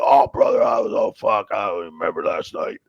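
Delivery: raspy shouted hungover rasp; Sandman voice. Retold or imitated. Sandman answering his ref friend, loud raspy hungover voice. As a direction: bellow it like a hungover brawler. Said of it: "He's like".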